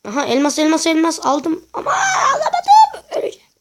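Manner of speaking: high, loud laughing shout; excited shout. Real, not acted. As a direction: yell with excitement, then laugh. Grabs diamond, misses it.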